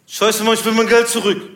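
high, loud gangster complaint; loud, pushy tough-guy voice; angry gangster customer. Retold or imitated. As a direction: demand it like an irate wannabe gangster. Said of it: "so ein Gangsterpärchen".

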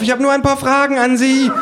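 high, loud call after someone fleeing; insistent high-pitched pollster shout. A narrator's reading. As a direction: yell it high while chasing. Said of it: "schreit sie und nimmt die Verfolgung auf".

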